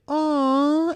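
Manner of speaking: put-on very high, loud baby coo. Retelling how he cooed at her belly, a very high aw. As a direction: coo sweetly at the bump.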